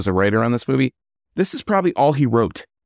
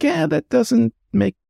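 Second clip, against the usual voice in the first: high mock voice; 7 semitones higher than usual. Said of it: "sometimes we're like".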